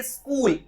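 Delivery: स्कूल straight from "s", no vowel before; correct pronunciation. Shown as a demonstration. Teacher giving the right form, स्कूल as "skool". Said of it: "आपको कहना चाहिए".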